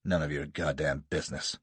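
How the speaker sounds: low, curt retort; snapping. A narrator's reading. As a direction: snap it back at him. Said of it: "snaps Jasser".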